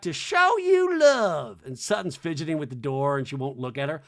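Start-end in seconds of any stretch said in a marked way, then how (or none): 0.0-1.6 high, loud, very slow. Retold or imitated. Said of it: "And [name]'s like".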